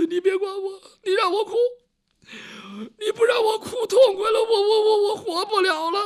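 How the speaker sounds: high, loud sobbing that breaks into a shout; loud wailing sobs. Acted. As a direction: cry it out unrestrained, voice high. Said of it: "他这可是真哭". (放声大哭，高声哭喊)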